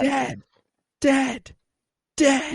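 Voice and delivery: very high, separated little cries; high-pitched mimicry. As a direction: imitate her, squeaking the word with pauses between. Re-enacting the character's shocked repetition.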